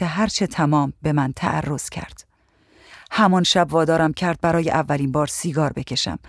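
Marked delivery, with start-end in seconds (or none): none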